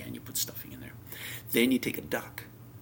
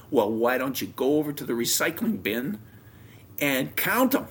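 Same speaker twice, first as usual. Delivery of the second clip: loud, insistent mock retort; retold challenge; 5 semitones higher, 6 dB louder than usual. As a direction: throw down the challenge in a retold squabble. Wife's challenge in retold quarrel.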